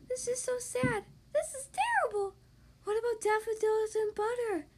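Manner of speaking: high, wailing little crayon; whiny high crayon voice. Acted. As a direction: wail about the lost colors. Yellow crayon laments lost colors.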